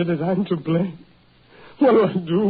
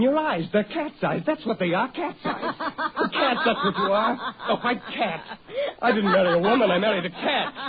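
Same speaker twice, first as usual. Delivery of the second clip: taunting through laughter, higher; mocking laughter; 5 semitones higher than usual. Husband jeering at his wife, laughing mockingly. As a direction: laugh cruelly at her as you jeer.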